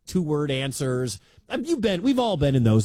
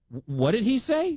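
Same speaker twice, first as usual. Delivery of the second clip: higher, stammering disbelief; mock double take; 7 semitones higher than usual. Host imitating stunned listener, mock surprise. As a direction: play a startled listener catching the line.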